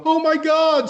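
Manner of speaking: high-pitched mock squeal; retold inner thrill. Retelling his inner thrill.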